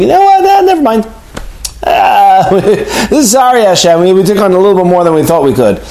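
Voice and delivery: high, sheepish, laughing apology; mock backing out. Israelites imagined apologizing to Hashem, sheepish laughing voice. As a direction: play a crowd sheepishly wriggling out of a promise.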